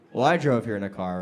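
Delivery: normal talking voice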